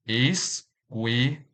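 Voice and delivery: slow "Escuela" split into three, es, cue, la; syllable by syllable. Shown as a demonstration. Teacher showing learners the syllables, "Escuela" slowly broken apart. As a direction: say "Escuela" with a pause after each syllable. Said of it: "This word has three syllables".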